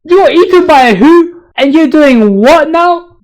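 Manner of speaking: high, shocked disbelief; incredulous. Pretend. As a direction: ask as if you can't believe it. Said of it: "wouldn't go".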